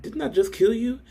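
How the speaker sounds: very high, stunned question; disbelief. Retold or imitated. Killer sees his victim stand up again, stunned high squeak. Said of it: "They're looking around like".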